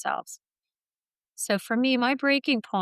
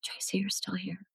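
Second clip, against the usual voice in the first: much softer, gentle reassurance; tender inner voice; 8 dB quieter than usual. Retold or imitated. Her heart comforting her after the crash, soft and tender. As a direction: soothe her like a caring friend. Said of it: "But my heart saying".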